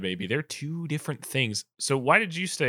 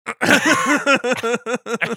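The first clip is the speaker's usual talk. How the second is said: loud high laugh; 9 semitones higher, 7 dB louder than usual. Real, not acted. Cracking up loudly at the weird stranger story.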